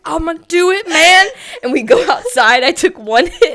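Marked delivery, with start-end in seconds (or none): 0.0-1.2 higher and louder, hammed-up resolve; mock brave promise. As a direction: belt it like going to war. Promising the friend she'll smoke.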